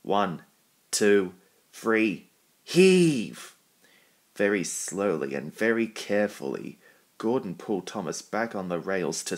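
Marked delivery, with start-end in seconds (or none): 0.0-3.6 building count to a shout. A narrator's reading. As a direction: build each number to a big heave. Counting off the big pull.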